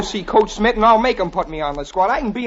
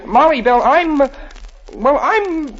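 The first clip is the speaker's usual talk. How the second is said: nervous confession; 7 semitones higher, slower than usual. Acted. Jimmy struggles to confess engagement.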